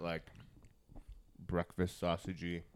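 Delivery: ordinary talk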